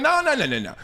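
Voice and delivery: lightly voiced coach, starting high and settling; straight-talking coach. Coach redirecting a last place team, lightly acted straight talk. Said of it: "coach don't come in there talking about".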